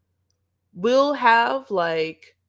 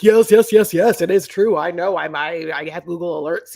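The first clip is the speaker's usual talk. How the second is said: loud eager burst, settling down; mock someone jumping in loudly to confirm; Kimberly; 8 dB louder than usual. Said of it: "Kimberly's like".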